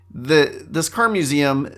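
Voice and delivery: usual speaking voice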